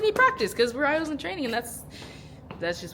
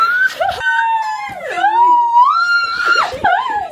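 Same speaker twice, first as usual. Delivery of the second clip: a far higher, louder screaming laugh; shrieking laugh; 8 dB louder than usual. Real, not acted. Friends hear the song start, a shrieking hysterical laugh. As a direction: lose it in a shrieking cackle.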